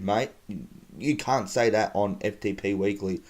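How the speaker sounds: normal talking voice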